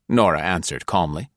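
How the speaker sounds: plain reading voice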